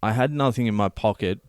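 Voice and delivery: ordinary talk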